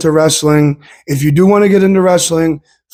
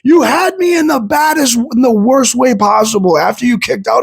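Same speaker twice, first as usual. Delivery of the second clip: brother's words retold as a high, half-shouted protest; retelling an outraged brother, voice high and pushed; mock shout; 6 semitones higher than usual. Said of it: "and he goes".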